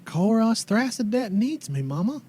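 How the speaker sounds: put-on wistful old mother recalling her boy; old townswoman.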